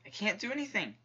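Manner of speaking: high, exasperated whine; helpless protest. Real, not acted. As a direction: pitch jumps up on "anything", then falls. Helpless in the game.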